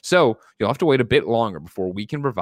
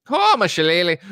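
hammed-up stage Irish accent, higher and louder; jokey Irish accent, pitched up; 9 semitones higher, 5 dB louder than usual. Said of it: "Should've read the whole thing in Irish accent".

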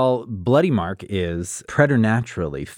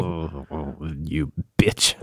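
laughing curse; 3 semitones lower, slower than usual. Acted. Outwitted killer laughs in character.

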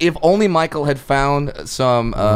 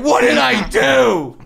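panicked driver; 11 semitones higher, 4 dB louder, slower than usual. Retold or imitated. Driver's panicked reaction, quoted.

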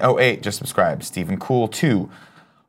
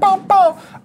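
high, loud sung-out booms; imitated booms; 4 dB louder than usual. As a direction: punch out playful high boom noises. Imitates booms at show's end.

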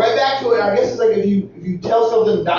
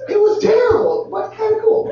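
proud tone. Real, not acted. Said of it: "weird point of pride that's in your voice".

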